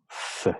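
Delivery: isolated S as a long voiceless alveolar hiss; S alveolar sorda. Shown as a demonstration. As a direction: hold "S" as a pure sss sound, not the letter name. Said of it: "el fonema fricativo alveolar". ("S" como silbido alveolar sordo y alargado)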